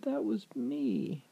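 high, slow character voice; witch's revelation. A narrator's reading. Witch revealing herself to the prince, slow high hurt voice. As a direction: reveal it slowly as the wronged witch.

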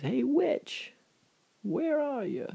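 high, loud challenging holler; acted shout, drawn out to carry; calling out. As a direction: call through a big house for your foe. Hero calls out for witch.

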